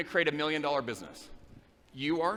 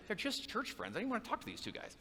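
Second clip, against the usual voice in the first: ordinary delivery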